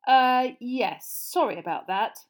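loud hesitant start, then easing; sheepish apology. Acted. Mister Weasley apologising for blasted fireplace, sheepish. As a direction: fumble into an apology.

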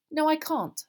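high, loud, snappy refusal; irritated, sharp refusal; snapped. A narrator's reading. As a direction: snap back at once. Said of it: "snapped Hermione".

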